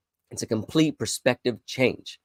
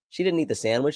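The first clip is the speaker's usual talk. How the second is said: ordinary delivery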